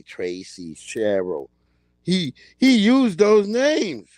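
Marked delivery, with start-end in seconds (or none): 0.0-1.5 lightly voiced [name], much lower and soft; a hint of [name] saying Tracy, Cheryl, low and lazy; imitating [name]. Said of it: "he say".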